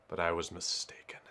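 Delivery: low, whispery, soft admission; dramatic hush. Pretend. Player admits the search failed, hushed mock-dramatic. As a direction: drop to a near whisper for drama.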